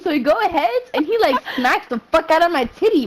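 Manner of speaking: ordinary talk, nothing marked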